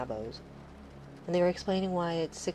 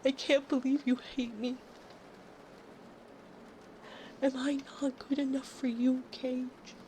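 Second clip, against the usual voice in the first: mock hurt; 7 semitones higher than usual. Streamer teasing a viewer she's not special, mock hurt. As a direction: pout at him, playfully wounded.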